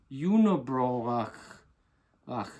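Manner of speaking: "Unibroch" drawn out with a guttural ending; exaggerated pronunciation. Shown as a demonstration. Hoser showing he can pronounce "Unibroch" properly, exaggerated guttural ending. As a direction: stretch "Unibroch", then hack out the final ch. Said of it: "I can pronounce it properly here".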